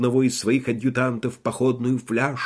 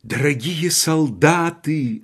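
orating; 4 semitones higher, slower than usual. Retold or imitated. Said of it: "ораторствовал фельдкурат Ибл".

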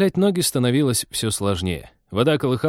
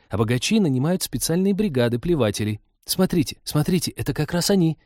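put-on higher girlish voice, keenly explaining; curious girl, getting excited; eager young woman; 4 semitones higher than usual. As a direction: share a discovery with growing excitement.

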